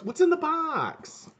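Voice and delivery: high, drawn-out wail without extra volume; hammed-up high-pitched begging; mock desperate plea. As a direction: wail the question, stretching BOX. Acted movie-style line, first take.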